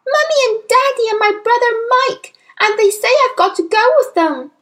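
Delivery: put-on very high, loud little-girl voice; upset, urgent child's outburst; distressed child. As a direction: pour it out in alarm. Distressed child reporting family fleeing.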